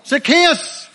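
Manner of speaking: loud, high, urgent shout; urgent call. Acted. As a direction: call him urgently by name. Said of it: "It's an urgent call".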